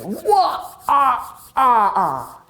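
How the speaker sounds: high, menacing villain cackle; hammed-up menacing laugh. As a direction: gloat with a wicked cackle. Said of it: "laughed menacingly".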